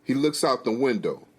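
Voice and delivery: ordinary delivery, nothing marked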